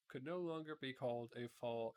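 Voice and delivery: plain reading voice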